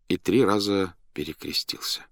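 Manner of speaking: plain reading voice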